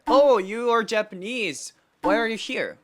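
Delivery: casual friendly why are you here; friendly normal question. Shown as a demonstration. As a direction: ask with friendly curiosity. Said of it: "That's normal".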